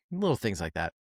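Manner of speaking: ordinary talk, nothing marked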